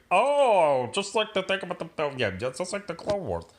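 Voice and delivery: a dumb mock fan voice; mock normie. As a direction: play a clueless fan. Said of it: "They're like".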